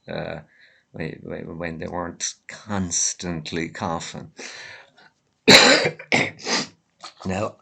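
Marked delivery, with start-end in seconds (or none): 5.5-6.7 loud chesty cough; a harsh real cough breaking the talk. Real, not acted. Vlogger with a head cold, coughing hard.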